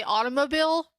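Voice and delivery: "automobile" ending in BEEL; auto-mo-BEEL pronunciation. Shown as a demonstration. Streamer asks chat about it, "automobile" as auto-muh-BEEL. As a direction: say "automobile" with a long E at the end. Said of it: "like that?".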